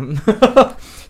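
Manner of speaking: awkward rising chuckle; nervous laugh. Real, not acted. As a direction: chuckle through the embarrassment. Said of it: "有点尴尬的进入下一个话题". (尴尬的笑声)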